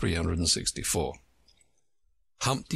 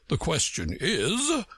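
slow, lofty egg's retort; pompous slow retort; Humpty Dumpty's voice; 7 semitones higher, slower than usual. A narrator's reading. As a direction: throw her words back, slowly and grandly. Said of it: "said Humpty Dumpty".

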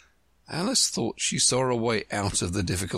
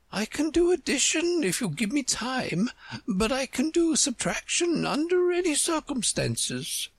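high-pitched queen defending herself. Acted. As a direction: answer in a much higher queenly voice.

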